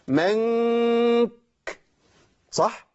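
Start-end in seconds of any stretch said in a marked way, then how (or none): 0.1-1.3 nasal ikhfa on منك, noon hidden and held long; ikhfa demo. Shown as a demonstration. Teacher demonstrating ikhfa of noon on منك. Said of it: "أنتوا عارفين كده أنا عملت إيه؟".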